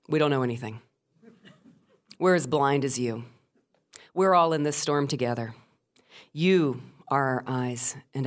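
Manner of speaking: low, measured on-air host; steady the listeners, low and unhurried with long pauses; calm reassurance. Retold or imitated. Storyteller as radio host addressing storm listeners, calm and grave.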